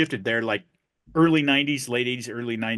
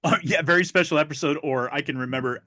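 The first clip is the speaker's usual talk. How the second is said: ordinary talk, nothing marked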